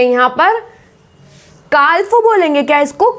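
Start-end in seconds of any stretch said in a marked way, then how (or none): 1.7-2.1 काल्फ with l before the f; wrong sounded l. Shown as a demonstration. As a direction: misread काल्फ as kaal-fu. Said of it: "it's wrong pronunciation".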